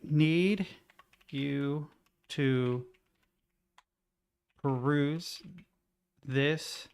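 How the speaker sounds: slow dictation. Real, not acted. Dictating a prompt while typing.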